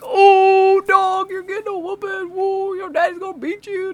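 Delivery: very high mock chorus of kids; taunting classmates. Whole class teasing Kyle about his whooping, high taunt. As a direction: jeer gleefully in a high kid voice.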